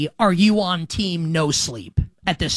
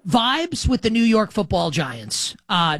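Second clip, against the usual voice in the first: usual speaking voice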